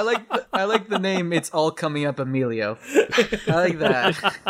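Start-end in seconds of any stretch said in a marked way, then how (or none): 2.9-4.4 laughing. Real, not acted.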